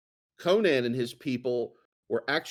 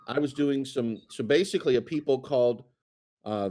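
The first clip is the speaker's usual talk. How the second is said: usual speaking voice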